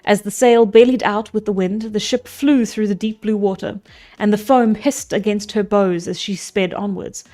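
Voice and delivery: steady storybook reading voice; story narration. A narrator's reading. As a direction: read it like an old epic tale. Resumes reading the Odyssey.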